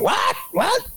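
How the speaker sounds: high-pitched, incredulous "what?" in imitation; Stephen A impression. Star told by his boss to apologize, high-pitched impression. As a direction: mimic a star's high squeal of disbelief. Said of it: "That was a good impression".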